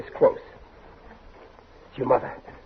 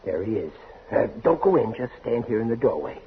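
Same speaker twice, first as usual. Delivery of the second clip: quiet whisper, much softer; hushed; 5 semitones lower, 8 dB quieter than usual. Acted. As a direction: murmur it at the doorway. Whispering at the bedroom door.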